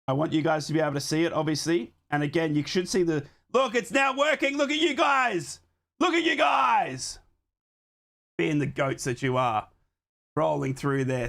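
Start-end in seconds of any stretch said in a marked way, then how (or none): 3.5-5.6 high, slow and delighted; excited. Real, not acted. Streamer thrilled his stats overlay works, high drawn-out cheer. 6.0-7.2 excited shout. Real, not acted. Streamer cheering his chat once the overlay loads, slow shouted.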